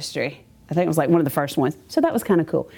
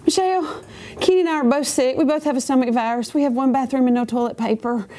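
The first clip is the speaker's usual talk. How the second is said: high-pitched, mock-tearful pleading; crying phone plea; 5 semitones higher than usual. Sick wife calling a friend for help, tearfully imitated. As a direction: act out a wailing call for help, voice pitched up. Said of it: "crying and go".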